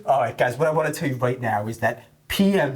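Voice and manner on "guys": ordinary talk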